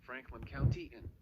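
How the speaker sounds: flat text-to-speech emergency alert voice; robotic warning read. Acted. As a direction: read it like an automated weather bot.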